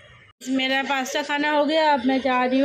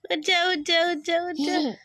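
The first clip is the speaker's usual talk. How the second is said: sing-song wake-up call; 7 semitones higher than usual. Real, not acted. Mother wakes sleepy daughter.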